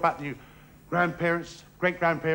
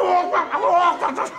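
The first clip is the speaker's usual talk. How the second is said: high, loud calls with walk pushed out first; the other man's comic high chant of walk and duck; high mock shouted walk and duck calls; 12 semitones higher, 5 dB louder, slower than usual. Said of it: "And he went".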